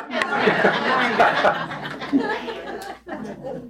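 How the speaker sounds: high, breathy laugh at his own punchline; delighted chuckle after the punchline; own joke laugh. Real, not acted.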